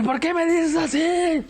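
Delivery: whining son talking back to his mother; whiny teen talkback. Retold or imitated. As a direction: complain like a hurt teenager to mom. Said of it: "y yo me ponía".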